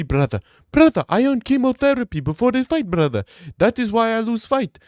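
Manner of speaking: put-on high, loud Dagestani fighter accent; mock Dagestani accent. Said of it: "Let me try that again".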